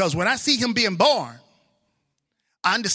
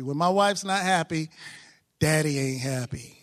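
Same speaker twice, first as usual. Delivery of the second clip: usual speaking voice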